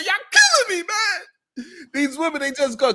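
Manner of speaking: high wailing imitation of a man sobbing; mock crying whine. Host re-enacts Joseph's tearful rant, high mock whine. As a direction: ham up the crybaby plea. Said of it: "This is what he did. He did the whole spell".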